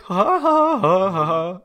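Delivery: high, drawn-out fake ha-has; slow-motion mock laugh. Mimicking a stuntman who went slow, drawn-out high laugh. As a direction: space out each ha in a high voice. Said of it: "went so slow and just like".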